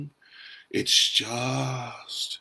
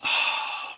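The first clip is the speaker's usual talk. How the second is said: relieving sigh. Shown as a demonstration. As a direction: drop the strain with a sigh. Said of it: "just take a moment and breathe".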